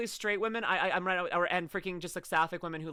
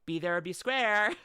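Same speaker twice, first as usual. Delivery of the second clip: ordinary talk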